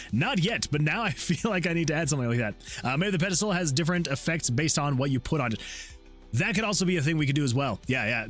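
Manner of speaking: ordinary delivery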